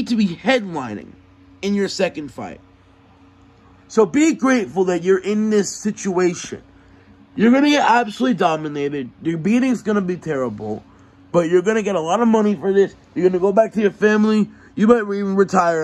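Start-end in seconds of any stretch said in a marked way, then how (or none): 3.9-15.8 fast, loud heated trash talk; angry rant. Real, not acted. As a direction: fire it off, louder and faster. Press conference trash talk heats up.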